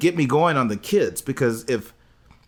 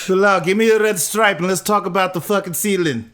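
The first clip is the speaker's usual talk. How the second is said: higher, badly faked Caribbean accent; unplaceable mock accent; 7 semitones higher, 3 dB louder than usual. Co-host mimicking the fiancée to Bilal, badly faked accent. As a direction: botch an island accent for laughs. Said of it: "what the fuck accent that was".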